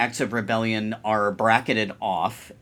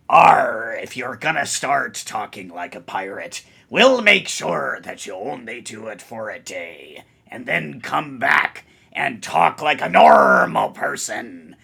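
pirate voice. Pretend. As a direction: ham up a salty pirate scolding. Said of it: "Talk like a pirate day".

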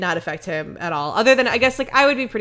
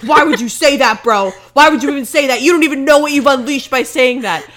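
loud, raised-pitch hammed-up scolding; mock aggressive; 5 semitones higher, 9 dB louder than usual. Ghost hunter berating his cameraman over the box, mock aggressive. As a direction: tear into him in mock outrage. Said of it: "very aggressive towards his cameraman".

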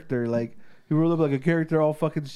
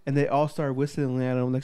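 ordinary talk, nothing marked

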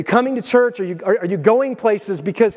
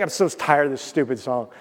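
low, soft mock weariness; acted sullen boredom, pitched down; bored grumbling; 9 semitones lower, 5 dB quieter than usual. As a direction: grumble like a fed-up listener. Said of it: "none of us are gonna be sitting there being like".

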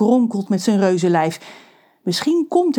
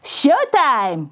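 higher triumphant cheer; 7 semitones higher than usual. Acted. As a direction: Pedro gloats. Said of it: "juicht Pedro". (Pedro juicht)

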